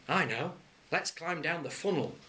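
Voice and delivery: put-on eager kid's idea; lightly voiced boy; child. A narrator's reading.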